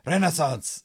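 hammed-up angry German accent; mock angry German. Shown as a demonstration. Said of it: "say Renaissance like you're angry".

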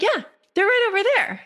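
very high, quick cartoony reply; eager helper. Bird guiding the squirrel to its nuts, eager. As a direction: answer fast in a high-pitched voice.

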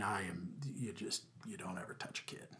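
whispered, voice dropped right out. Real, not acted. Father telling the host under his breath that you never touch a kid. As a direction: lean in and breathe it like a secret. Hushed aside on never hitting kids.

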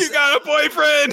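very high, loud excited cry; mock thrilled squeal. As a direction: squeal the news for a friend. Friend's thrilled outburst acted.

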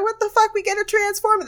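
high, shocked what the fuck; shocked disbelief. Retold or imitated. Partygoer seeing a transformer, high shocked disbelief. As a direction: yelp it in astonished disbelief. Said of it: "I was like".

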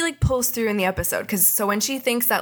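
ordinary talk, nothing marked

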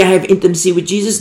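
rattled off fast; mock claim. Fast mock of the false believer's claim. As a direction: toss off the claim quickly. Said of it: "They might say".

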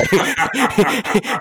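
sudden high burst of laughter; loud high laugh. Real, not acted. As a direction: let out a big laugh. Laughs teasing guest.

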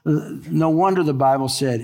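usual speaking voice

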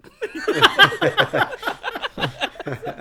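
very high mock cackle; imitated Sasha laugh. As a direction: put on a high, showy fake laugh. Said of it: "Give me your best Sasha imitation".

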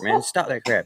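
usual speaking voice

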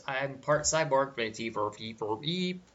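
stiff cyborg voice with beeps; robot voice. Pretend. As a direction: speak like a robot. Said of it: "my robot voice".

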